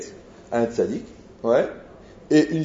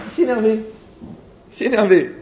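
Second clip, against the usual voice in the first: higher, louder exclamation; animated retelling; 9 semitones higher, 6 dB louder than usual. Retold or imitated. Rabbi telling how the young man got worked up, animated. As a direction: punch it out, high and loud.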